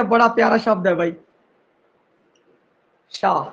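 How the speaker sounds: normal talking voice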